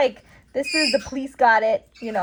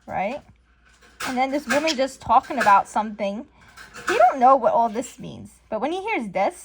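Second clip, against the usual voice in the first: ordinary talk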